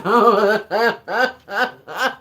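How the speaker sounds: real loud, rolling laugh; distinctive laugh. Real, not acted. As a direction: burst out in a long cackling laugh. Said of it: "that's an interesting laugh you got there".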